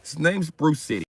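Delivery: usual speaking voice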